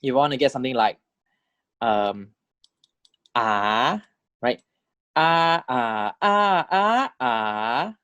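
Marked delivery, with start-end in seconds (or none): 5.2-7.9 "ah" rising high then dropping; "ah" in Thai fourth tone. Shown as a demonstration. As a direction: curve "ah" up and down. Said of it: "the tone four".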